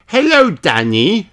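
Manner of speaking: high called greeting; shouting. Acted. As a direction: call across to a friend. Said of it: "shouts Peppa".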